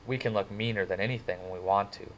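plain reading voice

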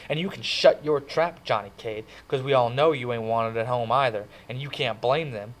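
hurt him on purpose; mad lash-out. Acted. Ponyboy lashes out at Johnny, mad and humiliated. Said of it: "That made me mad".

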